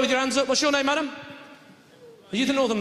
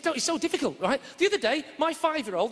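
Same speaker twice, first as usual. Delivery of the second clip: normal talking voice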